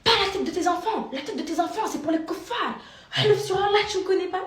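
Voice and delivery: hammed-up furious accuser; angry stranger. Vlogger mimicking the angry woman swearing on Allah, high and loud.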